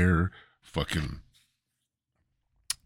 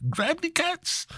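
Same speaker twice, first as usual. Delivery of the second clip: much higher, slow, badly faked Japanese accent; poor Japanese accent impression. Podcaster doing a bad, very high Japanese accent impression. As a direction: squeak out a crude fake Japanese accent. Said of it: "me poorly impersonating a Japanese person".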